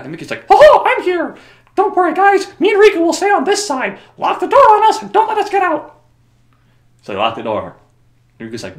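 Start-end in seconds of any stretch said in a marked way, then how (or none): 0.5-6.0 very high, loud squeaky falsetto; Mickey Mouse voice. Retold or imitated. Mickey telling them to lock the door, high falsetto.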